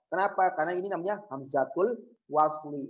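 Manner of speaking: normal talking voice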